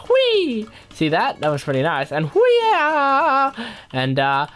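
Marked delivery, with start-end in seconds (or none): 0.1-0.7 sudden high squeal; high playful whee. Pretend. Gamer showing off practised dance moves, high squeal. Sound effect for showing off dancing. 2.3-3.5 high, drawn-out playful cry; high dance whoop. Pretend. Gamer showing off practised dance moves, high whoop. Sound effect for dance move.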